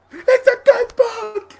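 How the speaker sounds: very high, slow lemur cry. Retold or imitated. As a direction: squawk it out drawn long. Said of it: "then the lemur was like".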